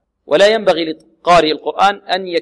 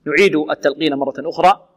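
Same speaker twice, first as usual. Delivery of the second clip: ordinary delivery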